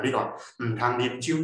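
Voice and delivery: usual speaking voice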